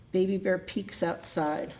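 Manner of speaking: ordinary delivery, nothing marked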